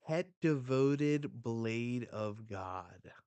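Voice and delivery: haughty bettor, drawn out at the end; doubtful bettor. Acted. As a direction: question the other's bet. Voicing doubtful bettor in manga.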